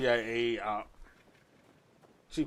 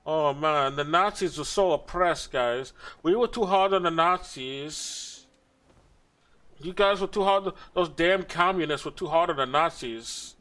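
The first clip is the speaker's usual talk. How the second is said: higher, sarcastic wailing complaint; mock lament; 5 semitones higher than usual. Streamer parodying a defector who pities Nazis, mock lament. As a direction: imitate an overwrought sympathizer, pitch raised and words stretched. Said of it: "She talks about, like".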